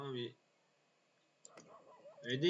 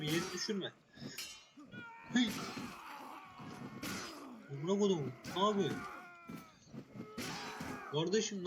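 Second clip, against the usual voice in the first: furious yelling; 7 semitones higher than usual. Real, not acted. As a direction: curse and yell at the brawling men. Raging at fighting NPCs in battle. (öfkeyle bağırma)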